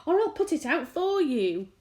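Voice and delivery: high, loud threat; threatening shout. A narrator's reading. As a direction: bark it as a warning. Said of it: "shouted the centipede".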